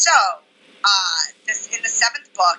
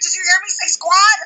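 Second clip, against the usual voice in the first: high, louder excited question; thrilled quote, pitched way up; excited retelling; 11 semitones higher, 6 dB louder than usual. Retold or imitated. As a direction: eagerly imitate a friend's question. Retelling friend's eager question to her.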